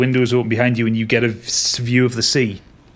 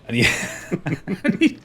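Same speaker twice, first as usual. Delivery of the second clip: usual speaking voice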